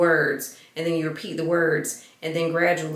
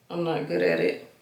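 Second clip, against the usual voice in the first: ordinary delivery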